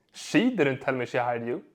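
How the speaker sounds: SHE leaned on, the rest let down; stress on SHE. Shown as a demonstration. Coach showing how emphasis changes meaning, stress on "she". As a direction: put the weight on "she". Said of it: "Same seven words, four completely different meanings".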